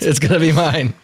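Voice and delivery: put-on playful German accent. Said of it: "that's a standard German accent".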